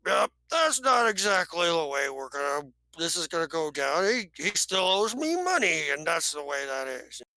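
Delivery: frog alien's put-on voice, insistent; put-on creature voice, staking a claim. Frog alien objecting that he is still owed money, put-on creature voice.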